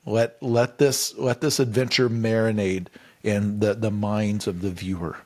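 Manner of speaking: lightly mocking, deliberately slowed pitch. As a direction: stretch each word like you're savoring it. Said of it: "they're like, before they're saying".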